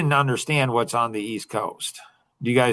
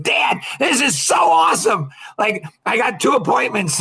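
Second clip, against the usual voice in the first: put-on higher, louder, thrilled young girl voice; excited daughter; 10 semitones higher, 5 dB louder than usual. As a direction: burst out with the good news to dad. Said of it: "she's on fire".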